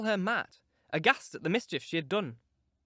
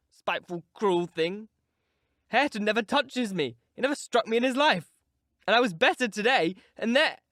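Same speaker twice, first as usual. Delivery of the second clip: whining complaint; 6 semitones higher, 6 dB louder than usual. Acted. Sickly boy complaining, voice dies.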